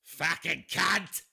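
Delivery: loud, drawn-out mock British swear; British accent. Shown as a demonstration. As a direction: belt it out in a put-on British accent. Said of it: "has to be said with a British accent".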